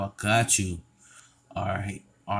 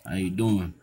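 usual speaking voice

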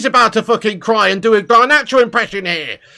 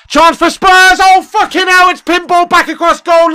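alarmed shout; 4 semitones higher, 7 dB louder than usual. Real, not acted. Reacting live to opponent goal.

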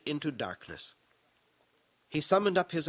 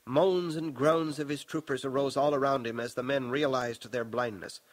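ordinary delivery

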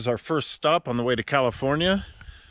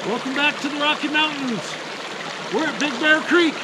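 much higher, bright greeting; upbeat welcome back; cheerful; 11 semitones higher than usual. Real, not acted. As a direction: announce the arrival with a grin. Cheerful arrival announcement.

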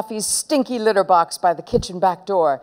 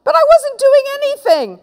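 high, loud howl of innocence; wailing, outraged denial; howled protest; 6 dB louder than usual. Acted. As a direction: howl it like a wronged child. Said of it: "howled Henry".

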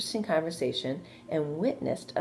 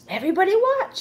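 higher, louder proud shout; excited cry; 7 dB louder than usual. A narrator's reading. Wilbur on the manure pile calling to the watchers, loud and high. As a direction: announce your big jump to everyone. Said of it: "he cried".

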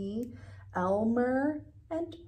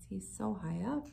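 usual speaking voice

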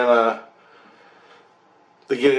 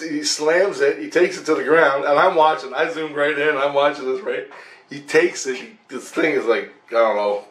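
ordinary talk

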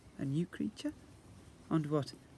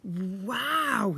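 stammered wow; 6 semitones higher, 4 dB louder than usual. Acted. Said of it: "he stammered".

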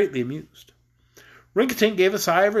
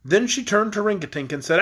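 plain reading voice

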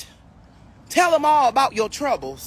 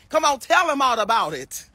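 ordinary talk, nothing marked

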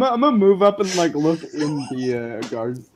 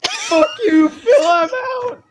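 high, loud mock fury, drawn out slowly; mock outburst; 4 dB louder, much slower than usual. Player joking he quits the game, shouting in mock rage. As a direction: storm off in fake outrage.

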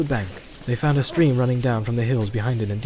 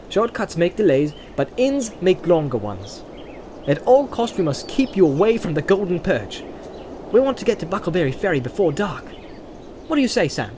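a lightly voiced Frodo, higher, making his case; Frodo, reasoning; 6 semitones higher than usual. A narrator's reading. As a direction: speak as a hobbit proposing a shortcut. Frodo's dialogue in read story.

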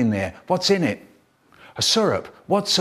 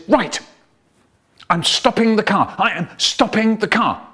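higher, sharp warning from the driver's seat; fed-up parent stopping the car; exasperated parent; 7 semitones higher than usual. Acted. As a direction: threaten to pull over.

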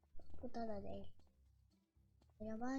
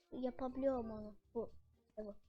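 ordinary talk

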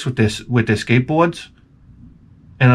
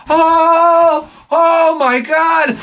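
very slow, much higher stunned gasp; drawn-out high amazed oh my God; amazed disbelief; 4 dB louder, much slower than usual. Retold or imitated. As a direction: savor the shock of good news. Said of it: "I was like".

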